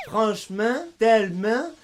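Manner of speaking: franchement, tellement finis en « min »; AN dit IN. Shown as a demonstration. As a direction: fermer la finale de franchement en IN. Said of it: "le en devient un". (« franchemin », « tellemin » à la québécoise)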